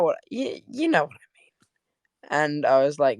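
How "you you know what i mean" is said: breathy hushed aside; whisper. Real, not acted. Whispering a confidential aside to the viewers. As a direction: lean in and whisper it.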